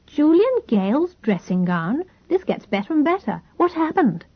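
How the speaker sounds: rising, gleeful disbelief; delighted surprise. A narrator's reading. Sister gleefully demands the scandalous details, delighted surprise. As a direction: pounce on the juicy news with glee.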